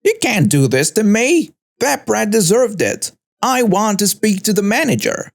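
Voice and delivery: entitled complaint, pitched well up; acted outrage with contained volume; mock screaming protest. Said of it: "She was kicking and screaming".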